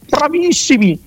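high-pitched mock praise; mock cheering. Fan cheering other teams from the sofa, mock cheering.

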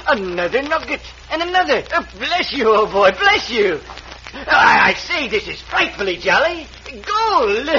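rising giddy delight; excited. Acted. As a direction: squeal with joy over the gold. Englishman finds gold nuggets.

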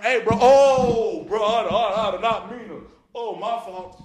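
loud, high, panicked sorry; flustered apology. Retold or imitated. Bro walking in on them, loud flustered apology. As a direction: blurt out apologies in a rush. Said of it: "He said, he walked in".

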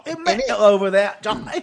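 hammed-up British banter; British accent. Co-host calling to an imaginary Johnny, mock British accent. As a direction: play a high-pitched mock Brit. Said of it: "British accents".

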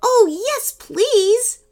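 put-on eager little girl. Rachel is offered to feed the dinosaur, eager high voice.

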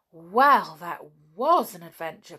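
a touch of pixie excitement; excited cry. A narrator's reading. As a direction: exclaim it with a loud opening word. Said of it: "cried the pixies".

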